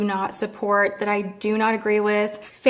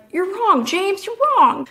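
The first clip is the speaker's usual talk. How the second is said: high-pitched mock reprimand; imitated scolding dad; 9 semitones higher, 3 dB louder than usual. Dad correcting James at dinner, mock scolding. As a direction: contradict him as a dad would, pitched well up. Dad contradicts his son, retold.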